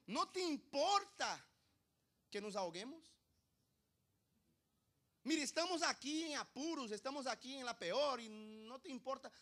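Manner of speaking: loud, high-pitched indignant complaint; acted angry outcry to the teacher; angry protest. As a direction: cry out accusingly in desperation. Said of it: "Y enojados".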